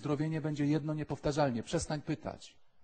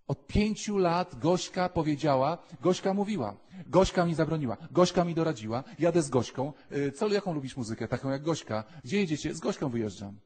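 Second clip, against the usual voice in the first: mocking a clingy girl; 4 semitones higher, 9 dB louder than usual. The priest mocks a girl who only talks about Gośka. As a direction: parody her Gośka obsession. Mocking girl's constant talk.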